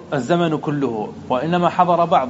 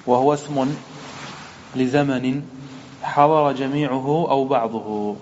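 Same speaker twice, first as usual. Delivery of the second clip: measured, even-toned recitation of a text; formal text reading; 3 semitones lower than usual. Acted. Teacher opening a grammar lesson from the book, formal monotone reading. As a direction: read it out evenly, on a nearly level pitch.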